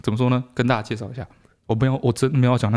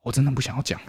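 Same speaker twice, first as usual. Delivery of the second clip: usual speaking voice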